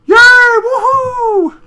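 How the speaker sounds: very high, loud drawn-out whoop; shouted cheer. Acted. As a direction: belt out a hammed-up celebration. Acted cheer from hidden-eyed pony.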